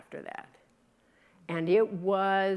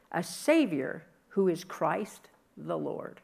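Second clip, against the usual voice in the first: steady, formal reading of Luke; scripture reading; 4 dB louder than usual. Acted. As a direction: read the Christmas story aloud to the congregation.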